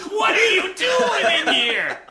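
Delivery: high, loud, drawn-out mock yell; hammed-up shout. Train conductor to cinema audience, drawn-out mock scream. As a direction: scream it in comic alarm, stretching the words.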